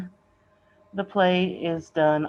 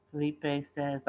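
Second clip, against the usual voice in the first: usual speaking voice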